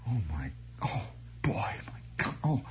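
whispered under the breath in dread; terrified whisper. Retold or imitated. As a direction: hush it like you're doomed. Dread at being called up.

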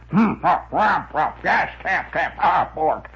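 blustering comic-strip windbag; windbag character. Retold or imitated. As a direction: harrumph and sputter between the words like a pompous windbag. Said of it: "without ever answering her straight".

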